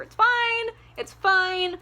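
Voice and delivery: high, sing-song it's fine, it's fine; sarcastic. Acted. Sarcastic dismissal of Hardin's violence.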